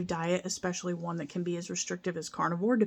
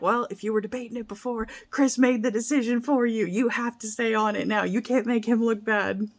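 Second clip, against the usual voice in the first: animated mock scolding, higher and louder; insistent friend's retort; 4 semitones higher, 5 dB louder than usual. Friend telling her she has to stay on it now, insistent. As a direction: imitate a friend laying down the law. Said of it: "she was like".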